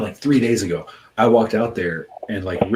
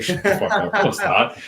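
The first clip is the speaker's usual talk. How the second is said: incredulous disbelief; 11 semitones higher than usual. Retold or imitated. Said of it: "I was like".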